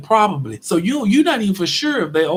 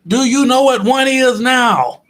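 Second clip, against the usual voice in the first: mocking challenge; 6 semitones higher, 6 dB louder, slower than usual. Mocking the clerk after explaining.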